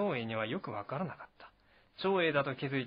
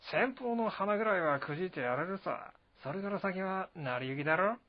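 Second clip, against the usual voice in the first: higher, carefree bravado; Zhang Fei, cocky; 5 semitones higher than usual. Acted. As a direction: shrug off the enemy with bravado. Zhang Fei brags about Cao Cao.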